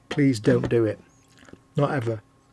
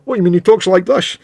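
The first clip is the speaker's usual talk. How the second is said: loud, higher mock-Scottish star voice; Sean Connery accent; 5 semitones higher, 5 dB louder than usual. Actor answering a producer about his role, mock Scottish accent. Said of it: "Sean Connery's anything accent".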